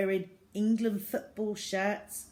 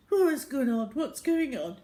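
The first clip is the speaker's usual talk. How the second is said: high, loud bewildered jolt; dad woken up in the pub; startled awake; 7 semitones higher, 7 dB louder than usual. A narrator's reading. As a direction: jerk awake confused. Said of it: "waking up with a start".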